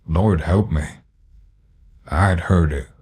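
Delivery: plain reading voice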